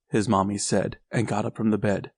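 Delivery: ordinary delivery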